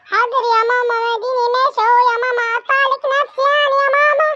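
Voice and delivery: put-on high, fast sulky little boy; a kid grumbling as he's dragged off; sulky child voice. Son grudgingly obeying his mother, high fast sulky child voice.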